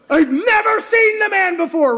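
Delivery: high, loud panicked shout of denial; desperate denial. Retold or imitated. Peter cornered in the courtyard, shouting his denial. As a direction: deny it with everything.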